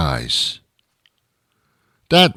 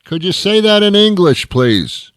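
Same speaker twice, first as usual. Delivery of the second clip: higher, louder yelling at the box; furious driver shouting at a drive-through speaker he can't understand; angry shouting; 7 semitones higher, 8 dB louder than usual. Acted. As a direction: yell back at the garbled speaker.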